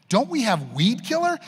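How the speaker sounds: put-on high, pleading kid whine; whiny kid complaint. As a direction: beg like a tired kid.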